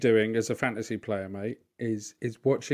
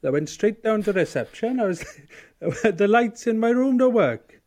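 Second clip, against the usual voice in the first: dad impression; 10 semitones higher, 7 dB louder than usual. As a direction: ham up the baffled dad at reception. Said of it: "he's like".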